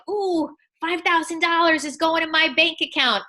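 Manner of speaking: high, delighted inner voice over the money; gleeful money thrill. Retold or imitated. Coach voicing her inner glee at a sale, gleeful and high. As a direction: savour the windfall with a gleeful coo. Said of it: "It would almost leak out of my eyes".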